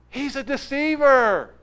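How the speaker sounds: high, loud, slow condemning cry; hostile crowd accusation. Acted. A hostile crowd member denouncing Jesus to others, high and loud. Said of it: "others are going".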